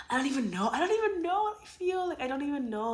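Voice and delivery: high, loud giddy overwhelm; overwhelmed excitement. Real, not acted. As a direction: let the excitement burst out. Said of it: "I'm so excited".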